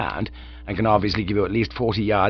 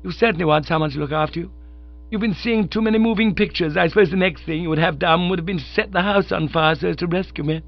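high, gushing and teasing; hearty young woman's voice; 8 semitones higher than usual. Acted. Honoria teases Bertie about the rescue, high and hearty. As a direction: scold him fondly from above.

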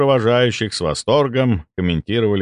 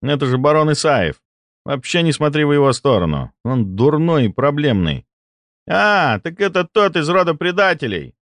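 put-on young noble voice; 8 semitones higher than usual. Nobles gossip about baron.

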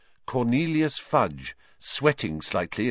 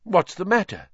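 startled; 10 semitones higher, 3 dB louder than usual. A narrator's reading. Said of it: "said Lupin, startled".

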